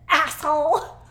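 put-on a small child yelling at the aide; angry child yell. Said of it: "Angry and yelling".

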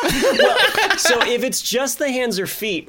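laughing while talking; words through laughter. Real, not acted.

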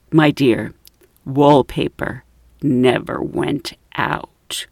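low, slow condescending correction; pompous highbrow man. Retold or imitated. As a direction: pronounce it grandly, with lofty pauses. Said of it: "He's a little bit of a pompous man".

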